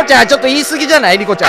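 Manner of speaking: high, loud, very fast interruption; alarmed, hurried telling-off; flustered protest. Acted. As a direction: rush in to rein her in. Cuts in to restrain the ranter.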